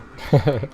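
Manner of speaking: quick amused laugh at himself; little self-amused laugh; short laugh. Real, not acted. Laughs at his own question tune.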